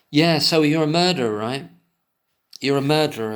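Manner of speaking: loud mocking interrogator; mock accusation. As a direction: throw the accusation with sarcasm.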